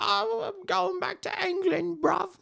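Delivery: high put-on British accent; mock British accent. Fighter leaving the alley for England, light British accent. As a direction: play a cheeky British lad. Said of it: "I was saying bruv".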